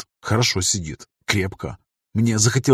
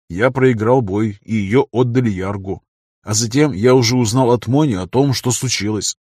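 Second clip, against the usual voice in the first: plain reading voice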